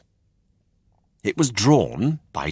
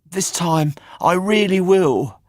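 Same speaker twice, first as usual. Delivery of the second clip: put-on higher, earnest little boy promising; eager puppet boy; 11 semitones higher, 4 dB louder than usual. A narrator's reading. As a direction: promise it with your whole heart. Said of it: "promised Pinocchio".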